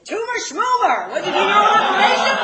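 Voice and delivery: high, loud comic blurting; exasperated mock shout; mock outburst. [name]'s mocking outburst before next take.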